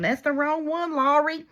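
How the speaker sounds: high, loud mock holler; imitated viewer yelling. Viewer hollering at her about her mistake, mock yell. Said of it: "yelling just loud as they could at me".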